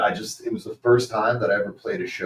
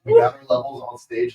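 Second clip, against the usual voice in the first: normal talking voice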